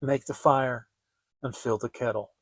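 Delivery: ordinary delivery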